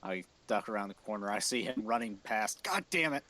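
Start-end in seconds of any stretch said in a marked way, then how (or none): 2.6-3.2 higher-pitched exasperated outburst; frustrated mock yell. Player voices a character chasing someone round the corner, mock frustrated yell. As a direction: play at cursing in frustration.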